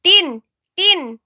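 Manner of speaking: "Tin!" called high and loud in a Filipino accent; repeated loud, high name-call, Filipino accent. Shown as a demonstration. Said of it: "in, like, this accent".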